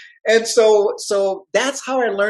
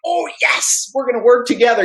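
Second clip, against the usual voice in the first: high, re-enacted delight; elated retold reply, pitched up; thrilled reaction; 4 semitones higher than usual. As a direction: act out jumping at great news. Acting out his thrilled reply.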